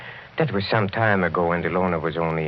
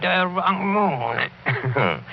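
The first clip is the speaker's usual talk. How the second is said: slow, higher, mocking repeat; mocking echo; 11 semitones higher, 3 dB louder, much slower than usual. Count mocks the doctor's warning, slow and teasing. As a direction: drag it out to mock him.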